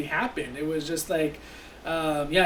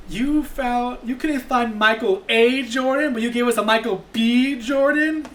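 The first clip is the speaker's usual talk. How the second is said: higher, louder, slowed cartoon complaint; stretched-out, raised-pitch mock outrage; Daffy Duck; 6 semitones higher, 4 dB louder than usual. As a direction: imitate an indignant cartoon duck. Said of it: "Daffy Duck was like".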